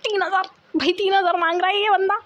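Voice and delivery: high-pitched disbelief at the amount; shocked father. Retold or imitated. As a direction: imitate a dad reeling at the price. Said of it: "उसके पापा तीन हज़ार सुन के shock". (हैरान पापा की नकल, ऊँची आवाज़ में)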